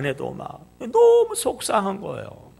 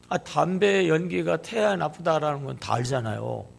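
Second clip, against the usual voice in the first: ordinary talk, nothing marked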